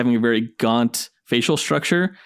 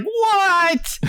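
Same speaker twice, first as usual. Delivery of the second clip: very high, drawn-out disbelief; astonished squeal. Retold or imitated. Fan reliving the surprise Channing Tatum reveal, astonished.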